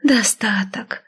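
slow, breathy mock-sweet echo; sugary, drawn-out taunt; sweet mocking singsong. A narrator's reading. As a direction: croon it back condescendingly, stretching the word. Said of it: "сладко пропела я".

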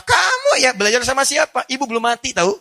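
high, loud scolding question; angry mother. Retold or imitated. Mother shouting at her child over the odd name. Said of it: "Ibu Anda marah".

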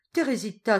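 warning call. Acted. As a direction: call her name to stop her.

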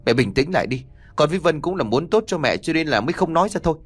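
ordinary delivery

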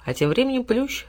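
ordinary delivery

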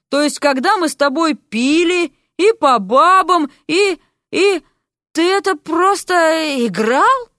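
high, slow, halting accusation; faltering outrage, stretched and high; hurt indignation. A narrator's reading. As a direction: stumble through it, aghast at being deceived. Betrayed friend stammers an accusation.

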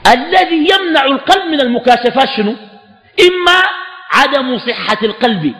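high, drawn-out classical recitation; emphatic text reading. A narrator's reading. Teacher lists what blocks the heart's unveiling, emphatic formal recitation. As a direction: stretch each listed cause out slowly. Reads the book's text aloud.

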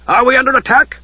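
loud, urgent shout; roaring. Acted. Lord shouting amid the explosions. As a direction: demand it over the chaos. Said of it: "Harry Aiken roared".

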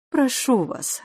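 understated nervous appeal; mildly flustered. A narrator's reading. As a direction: plead with a touch of agitation. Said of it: "разволновалась мисс Петтигрю".

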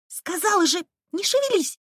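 high, insistent repeated command; insist, losing patience; insistent order. A narrator's reading.

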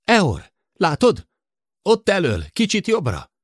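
high, loud, urgent pointing out; excited calling out. A narrator's reading. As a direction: shout directions to the pilot. Excited sighting, Eor shouts too. (izgatott, hangos odakiáltás)

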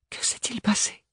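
un souffle sans voix, inquiet; chuchoté. A narrator's reading. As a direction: murmurer la question tout bas. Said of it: "chuchota-t-elle". (Ophélie chuchote au téléphone, angoissée)

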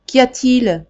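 Daniel calling down over the banister. Acted. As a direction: call down to someone unseen.

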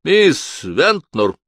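slow, loud call. A narrator's reading. The lawyer calls for his secretary, raising his voice. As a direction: call her name down the hall. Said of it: "Эмберли повысил голос".

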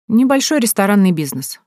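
plain reading voice

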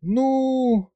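high, stretched-out syllable; drawn-out hesitation. A narrator's reading. Specialist asked for her verdict, drawling. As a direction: stall on one long high note. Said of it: "протянула Маша".

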